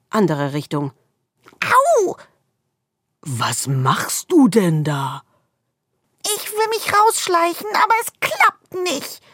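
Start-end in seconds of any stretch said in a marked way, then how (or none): 1.6-2.2 high sharp ouch; squirrel yelp. A narrator's reading. Squirrel bumps into the wall, high yelp. 3.2-5.2 darkness growling. A narrator's reading. 6.2-9.2 much higher, stammering and hurried; flustered squirrel. A narrator's reading. Squirrel stammers an excuse to the darkness, high and flustered.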